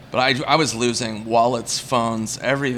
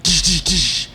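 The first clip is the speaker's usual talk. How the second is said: mock tabloid headline with imitated sound effects; slow, punched-up fake show bumper; TV show announcer; slower than usual. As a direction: announce it like a gossip-show sting. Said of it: "they put it on the show, like".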